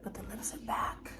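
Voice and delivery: soft unvoiced whisper. Real, not acted. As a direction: breathe it out as an aside. Quiet aside after lip filler.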